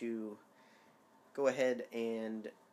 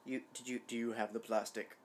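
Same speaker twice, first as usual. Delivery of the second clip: normal talking voice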